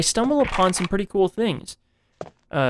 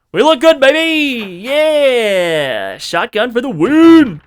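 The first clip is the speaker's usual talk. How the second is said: loud, high, slow victory whoops; triumphant cheering; 8 semitones higher, 8 dB louder, much slower than usual. Real, not acted. Gamer celebrates the shotgun swap working, triumphant cheer. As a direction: celebrate like you just won. Shotgun trap test succeeds.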